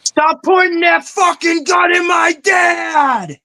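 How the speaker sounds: high, slow shouted movie line; movie quote shout. Retold or imitated. Host shouts the Reservoir Dogs line, high and slow. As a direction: yell it like the gangster film scene.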